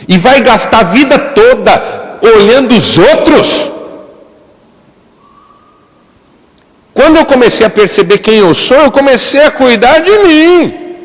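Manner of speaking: high, loud preaching climax; fiery preaching. Acted. Pastor preaching to the congregation, high and loud at full force. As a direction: drive the message home to the congregation.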